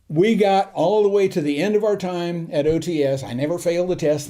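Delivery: plain reading voice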